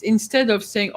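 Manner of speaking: normal talking voice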